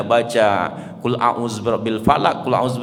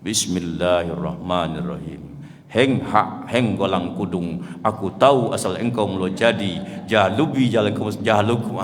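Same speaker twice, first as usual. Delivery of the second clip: low, muttered spell chant; old man's folk incantation; 5 semitones lower than usual. Acted. Grandfather healing the baby's belly with a low chanted spell. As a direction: chant it like a village healer's spell. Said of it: "dibacanya doa bahasa gitu".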